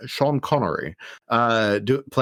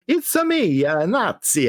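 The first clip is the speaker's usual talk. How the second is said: high cartoon Italian accent; silly Italian accent; 5 semitones higher than usual. Podcaster leaning into the botched accent, high cartoon Italian. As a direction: ham up a bouncy Italian accent. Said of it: "gonna do Italian accents for German Nazis".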